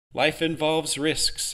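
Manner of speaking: slow and weighed words; slow, hesitant. Acted. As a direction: say it slowly, thinking. Adam answers hesitantly.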